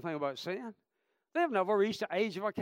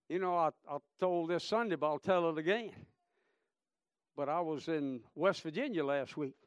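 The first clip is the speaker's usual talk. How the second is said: normal talking voice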